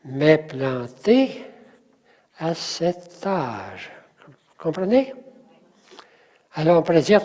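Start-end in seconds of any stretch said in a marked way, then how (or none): 0.0-3.9 very slow, landing on "-té" in "planté" and on "âge"; stress on last syllable. Shown as a demonstration. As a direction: spell out the rhythm, pause after "planté". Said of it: "l'accent est là". (très lent, accent final sur « planté » et « âge »)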